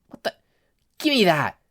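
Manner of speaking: snatch it back, irritated; annoyed thug. Acted. Setsuno snatching peppers, annoyed and loud.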